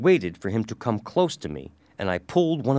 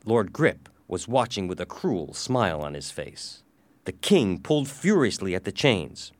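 plain reading voice

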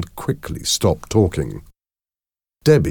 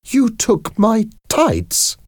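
high, slow, outraged disbelief; shocked exclamation; slower than usual. A narrator's reading. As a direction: exclaim it high, drawing out each word. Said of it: "exclaimed Debbie".

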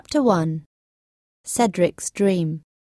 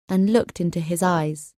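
usual speaking voice

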